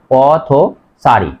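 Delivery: পথচারী said very slowly, each syllable clear; slow model word. Shown as a demonstration. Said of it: "উচ্চারণ লেখার সময়". (পথচারী ধীরে ধীরে উচ্চারণ)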